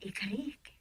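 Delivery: soft unvoiced whisper. Woman whispers a promise to her partner. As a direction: breathe the promise.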